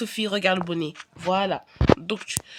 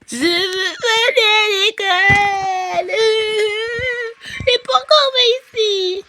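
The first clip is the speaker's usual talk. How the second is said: slow, high, louder crying tantrum; wailing toddler; 9 semitones higher, 6 dB louder, slower than usual. Acted. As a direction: bawl it like a four-year-old. Sophie wails about school. (crise de larmes d'enfant, aiguë et forte)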